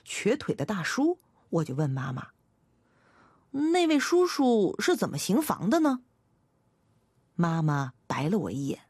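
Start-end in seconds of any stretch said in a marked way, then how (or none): 3.7-6.0 put-on higher, innocent child's question; curious child. A narrator's reading. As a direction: ask it high, with childlike curiosity. Curious child asks mother.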